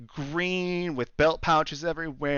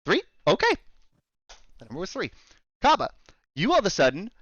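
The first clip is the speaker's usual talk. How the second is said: ordinary talk, nothing marked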